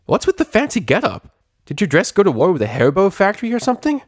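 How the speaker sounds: high, mocking pegasus; teasing tomboy voice. A narrator's reading. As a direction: tease her outfit. Rainbow Dash teasing.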